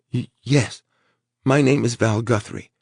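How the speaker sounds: high, shaky frightened voice; frightened stammer. Acted. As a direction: stammer it out scared. Frightened Val answers captor.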